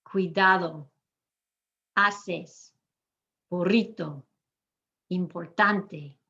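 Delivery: slow word list, "burrito", "caminan" leaning on the next-to-last syllable; second-to-last syllable stressed. Shown as a demonstration. Teacher giving examples of the rule, "Hermano" stressed on its middle syllable. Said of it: "underlined the syllable I'm emphasizing".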